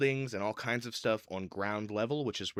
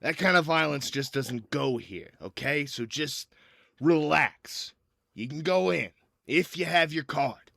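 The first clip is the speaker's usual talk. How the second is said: loud, higher-pitched guard laying down rules; raised guard voice, stretching into a relenting order; gate guard; 6 semitones higher, 7 dB louder than usual. Acted. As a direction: talk down a hothead, firmly and loudly. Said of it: "and the guy goes".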